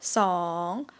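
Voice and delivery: song dips low and rises; Thai rising tone. Shown as a demonstration. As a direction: scoop song down then up. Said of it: "rising tone".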